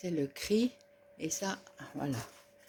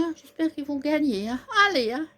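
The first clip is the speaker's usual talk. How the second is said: ordinary talk, nothing marked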